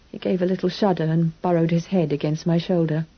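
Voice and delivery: usual speaking voice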